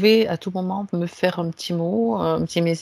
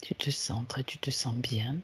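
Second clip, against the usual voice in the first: guided meditation; 3 semitones lower, 7 dB quieter than usual. Acted. Leads a guided relaxation. (voix douce de méditation guidée)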